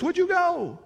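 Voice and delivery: lightly voiced urgent begging townsfolk; a hint of townsfolk begging Jesus to leave; begging townspeople.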